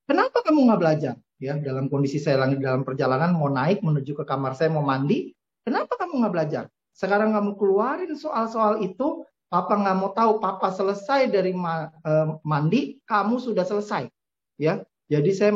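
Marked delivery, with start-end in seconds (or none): 0.0-1.1 stern scolding. Retold or imitated. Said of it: "Lalu saya bilang gini". 5.6-14.1 stern scolding. Retold or imitated. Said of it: "Lalu saya bilang gini".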